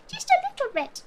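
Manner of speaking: "Just a little bit" lifted high and playful; high sing-song. Acted. Echoing her own words to chat in a high sing-song. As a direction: toss the line up high, teasing. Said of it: "repeated line".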